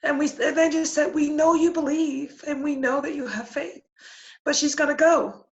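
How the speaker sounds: high, tearful voice on the verge of crying; holding back tears while retelling the angels' words; getting emotional. Real, not acted. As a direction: voice catching with real emotion. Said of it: "Oh, I'm gonna get emotional".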